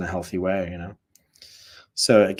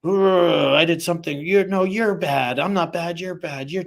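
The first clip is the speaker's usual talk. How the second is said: higher, whiny defensive voice; mock sulky you're bad, much higher and louder; defensive shamed reply; 8 semitones higher, 5 dB louder than usual. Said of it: "I didn't play the other end of".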